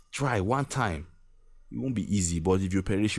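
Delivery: normal talking voice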